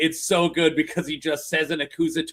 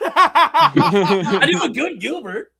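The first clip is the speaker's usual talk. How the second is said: loud slow grating comic voice; Gilbert Gottfried; 8 semitones higher, 7 dB louder, much slower than usual. Pretend. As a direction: squawk it out like Gilbert. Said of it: "I could Gilbert Godfrey".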